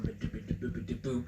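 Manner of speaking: playful scat syllables while waving an arm; silly scat to show a repeated joy; sing-song scat. Shown as a demonstration. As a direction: bop out the nonsense sounds. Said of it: "just like when I'm waving my arm".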